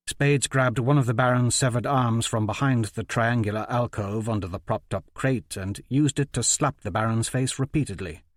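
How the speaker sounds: ordinary delivery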